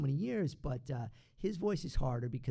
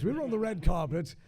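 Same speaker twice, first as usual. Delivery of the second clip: louder, posh British delivery; posh British accent; 5 semitones higher, 8 dB louder than usual. Shown as a demonstration. Voice double retelling a premiere meeting, loud posh British accent. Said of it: "It sounds very posh".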